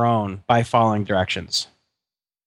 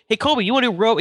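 put-on coaxing the kid; 9 semitones higher, much faster than usual. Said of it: "You go".

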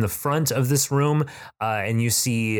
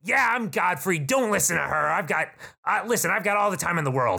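high, chummy goblin salesman; high, overfriendly goblin voice; eager goblin shopkeeper; 8 semitones higher, faster than usual. Acted. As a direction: gush over the customer like a pushy shopkeeper. Goblin Godfrey again.

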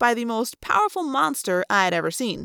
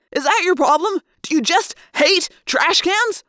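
high-pitched, incredulous questioning; exasperated berating; 8 semitones higher, 4 dB louder than usual. A narrator's reading. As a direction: demand an answer, slowing to hammer each word. Passenger berates driver after crashes.

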